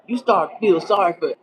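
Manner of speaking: ordinary talk, nothing marked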